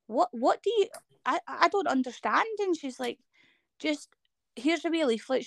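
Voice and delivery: high, bewildered retelling; baffled. Retold or imitated. Guest recalling the doctor's leaflet, high and bewildered. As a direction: raise the voice in disbelief at the leaflet.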